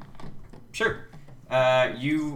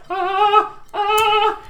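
high, drawn-out "ah" of fright; panicked high cry; frightened wail; 6 dB louder than usual. Acted. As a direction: wail twice, high and loud. Frightened woman backing away.

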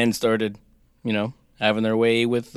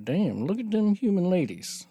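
leering angel; 6 semitones higher, 5 dB quieter than usual. Retold or imitated. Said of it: "were like".

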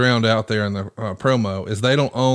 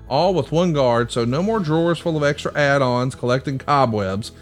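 upbeat sponsor read, a little higher; 5 semitones higher than usual. Acted. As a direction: pitch the product like a host ad. Host reads sponsor ad.

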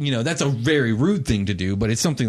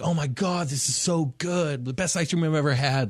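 stoked; 5 semitones higher than usual. Acted. Game master voices happy ice cream customers, stoked. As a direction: gush in a raised voice, drawing out the praise. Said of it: "people look stoked".

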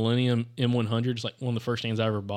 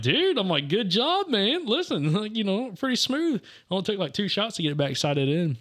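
high, sarcastic congratulations, laughing through it; hammed-up pat on the back, pitched way up; mock praise; 9 semitones higher than usual. Teasing a hunting buddy about his quick sight-in, mock praise up high.

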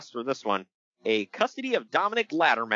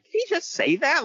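shocked disbelief; 12 semitones higher than usual. Retold or imitated. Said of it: "I was like".

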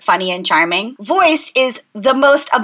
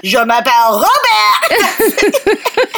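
drawn-out, higher hammed-up French accent; Alec Baldwin, French accent; 6 semitones higher, much slower than usual. Host quoting a comedy sketch French lesson, overblown French accent. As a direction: stretch out the French, theatrically, through laughter. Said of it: "S N L sketch with Alec Baldwin".

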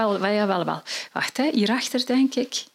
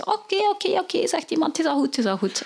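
high, resigned okay; husband giving in to his wife; 8 semitones higher, faster than usual. Acted. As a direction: give in to her, pitched up.

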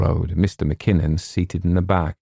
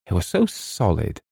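plain reading voice

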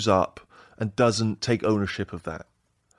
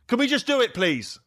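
high-pitched, exasperated plea at usual volume; demonstrated bark; 12 semitones higher than usual. Shown as a demonstration. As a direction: show a controlled bark of impatience. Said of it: "What's a bark?".

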